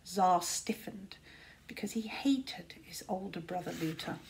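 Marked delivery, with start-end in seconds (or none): 1.7-4.2 soft, breathy narrator whisper; hushed whisper. A narrator's reading. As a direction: whisper it like a dark secret. Narrator hushes on hated brother.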